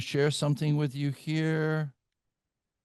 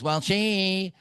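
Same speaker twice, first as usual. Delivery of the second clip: high, drawn-out whine on "she"; mock accusing husband; 6 semitones higher than usual. Husband blaming his wife to the pastor, mock accusing. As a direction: imitate a spouse shifting the blame.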